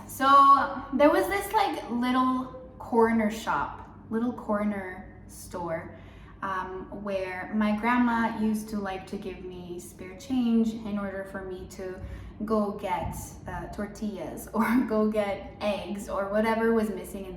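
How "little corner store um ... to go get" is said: normal talking voice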